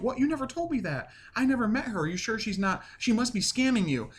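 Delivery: high-pitched disbelieving questioning; mock shock. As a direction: act out an indignant, suspicious reaction. Acting out shock at son's marriage.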